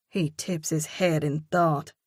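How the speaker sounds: plain reading voice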